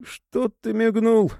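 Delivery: can't believe he talked back; surprised. A narrator's reading. The thug stunned by Maxim's defiance, surprised. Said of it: "удивился особняк".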